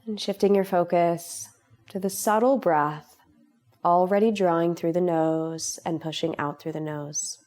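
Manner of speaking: calm, unhurried meditation voice with long pauses; guided meditation. Real, not acted. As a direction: guide them gently into relaxation, leaving space between phrases. Leads a guided relaxation meditation.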